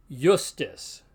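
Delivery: justus starting with a Y sound; consonantal I as Y. Shown as a demonstration. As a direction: say justus as "yoos-toos". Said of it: "pronounced like our consonant".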